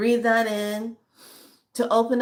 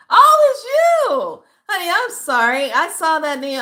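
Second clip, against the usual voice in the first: delighted recognition; 11 semitones higher, 9 dB louder than usual. Real, not acted. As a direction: burst out happily on recognizing her.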